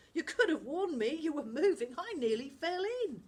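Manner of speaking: loud, high, reproachful; a boy nearly tipped overboard; indignant boy voice. Acted. Amir to Charlie and Jazz, loud and cross.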